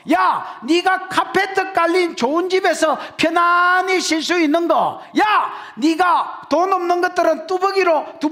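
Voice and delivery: high, forceful sermon cry with stretched key words; impassioned preaching. Real, not acted. Preacher urging congregation to proclaim God's grace, impassioned preaching. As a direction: drive each point home in a high preaching voice.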